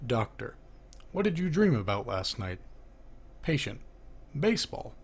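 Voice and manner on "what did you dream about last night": usual speaking voice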